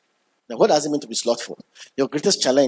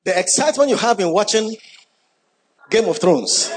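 laughing excitedly; 7 semitones higher than usual. Real, not acted. Preacher teasing the congregation, laughing and excited. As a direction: bubble over with glee at the joke. Joking about bingeing Game of Thrones.

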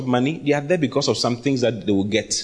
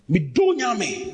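mock curse; 7 semitones higher, much slower than usual. Mimicking an angry curser.

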